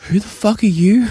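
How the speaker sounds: mock outraged. Acted quarrel, hungover host.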